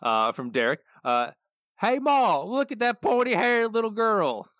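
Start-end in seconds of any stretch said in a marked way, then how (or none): 1.8-4.5 high, louder, slow hillbilly call; excited yokel shouting to his mother; high hollering country drawl; Cletus voice. Retold or imitated. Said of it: "I'll start out doing the Cletus voice".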